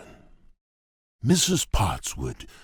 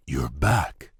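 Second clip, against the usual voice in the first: normal talking voice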